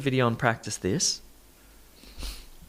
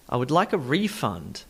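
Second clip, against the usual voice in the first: refund said REE-fund, as a noun; noun stress on first syllable. Shown as a demonstration. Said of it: "Refund is the noun".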